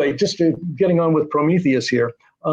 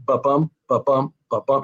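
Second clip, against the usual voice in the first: "ba-bum" in steady pairs, weak BA then strong BUM; heartbeat iambic beat. Shown as a demonstration. Writer showing what iambic meter is, heartbeat stress on each "bum". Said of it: "It's like a heartbeat".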